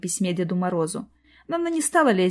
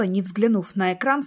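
usual speaking voice

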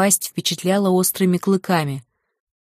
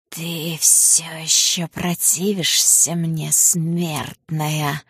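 surprised serpent; much slower than usual. Acted. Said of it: "Удивление в голосе змея". (удивлённый медленный голос змея)

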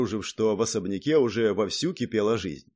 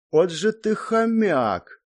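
slow, drawn-out higher exclamation; admiring; 8 semitones higher, 3 dB louder, much slower than usual. A narrator's reading. Hero marvelling at Zak's hoarding, admiring. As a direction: draw it out in admiration. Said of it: "восхищённо посмотрел я на Зака".

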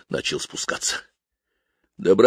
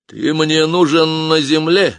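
businesslike; 9 semitones higher, 5 dB louder, slower than usual. Acted. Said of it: "деловито произнёс дудочник".

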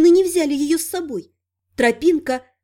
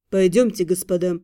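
inviting; 8 semitones lower than usual. A narrator's reading. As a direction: gently invite them in. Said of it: "пригласил Дрей".

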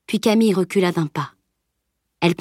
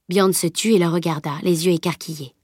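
usual speaking voice

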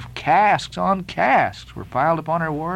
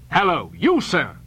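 cried out. A narrator's reading. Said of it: "cried the captain".

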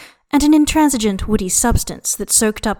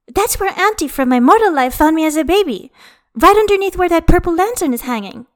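excited; 9 semitones higher, 5 dB louder than usual. A narrator's reading. Jinmi excitedly pointing out place.

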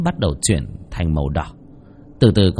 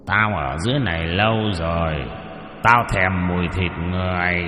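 skeleton voice; 3 semitones lower, 4 dB quieter than usual. Acted. Skeleton demon craves human flesh.

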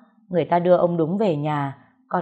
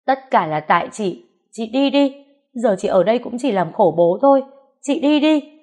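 loud and much higher, climbing to a shriek; tearful screaming; 5 semitones higher, 6 dB louder than usual. Acted. Younger sister screaming at older sister to leave, tearful. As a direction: scream her sister away in grief. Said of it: "Con Tún cũng đau lòng mà gào lên".

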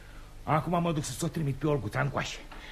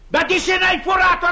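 high, loud outraged question; indignant shout; 12 semitones higher, 8 dB louder than usual. Acted. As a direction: bellow it at the young man. Father berating suitor. (strigăt indignat către pețitor)

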